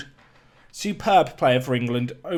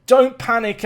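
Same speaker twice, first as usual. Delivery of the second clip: higher, louder don't panic; louder anxious plea; 7 semitones higher, 7 dB louder than usual. Real, not acted. Manager shouting to the players, anxious and loud. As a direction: beg them not to panic while panicking.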